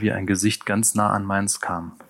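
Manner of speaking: plain reading voice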